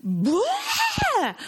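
a sudden burst of tears, acted out as she retells it; sudden sob burst. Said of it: "I just went".

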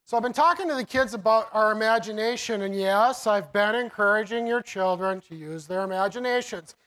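usual speaking voice

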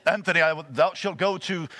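God as a stately Englishman; majestic British accent. Acted. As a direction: intone a divine command in mock British. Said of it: "some British majestic accent".

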